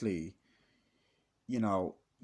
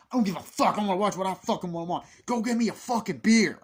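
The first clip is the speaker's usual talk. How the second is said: high, loud hammed-up rant; mock drunken yell; 8 semitones higher, 10 dB louder, faster than usual. The stepdad yelling at Michael for a beer, high mock yell. Stepfather yelling in retold scene.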